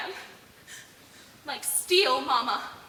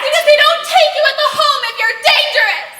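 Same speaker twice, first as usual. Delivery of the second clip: loud, higher defensive cry; heated shout; 4 semitones higher, 11 dB louder than usual. Acted. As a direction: defend him at full voice. Heated family quarrel.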